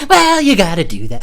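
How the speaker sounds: high, loud goofy outburst; put-on high, loud voice; goofy catchphrase voice. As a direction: blurt it like a cartoon catchphrase. Running catchphrase bit in put-on voice.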